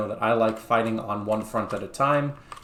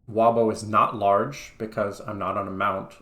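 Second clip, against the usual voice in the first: ordinary delivery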